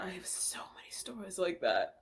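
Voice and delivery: softly whispered aside; breathy whispered repeat. Shown as a demonstration. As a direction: whisper it like a secret. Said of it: "repeated whispered".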